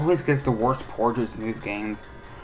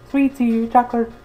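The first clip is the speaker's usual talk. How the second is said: high, slow sing-song seller's voice, much higher; sweets trolley lady; 8 semitones higher, slower than usual. Acted. The trolley lady offering sweets to the kids, in a high sing-song voice. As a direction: call out the treats like a cheery trolley witch.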